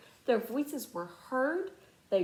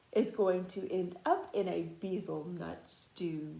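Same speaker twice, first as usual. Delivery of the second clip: plain reading voice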